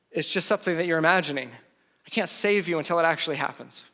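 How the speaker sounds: ordinary delivery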